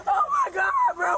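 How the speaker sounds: high sobbing cry; crying shout. Real, not acted. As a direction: wail it out in tears. Pleading during arrest.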